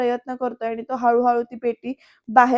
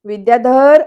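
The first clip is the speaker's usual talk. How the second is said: calling the name out much louder, rising; sharp call; 10 dB louder, slower than usual. Retold or imitated. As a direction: catch him in the act with a shout. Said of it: "एकदम हाक मारतो विद्याधरला". (जोरात दरडावून हाक)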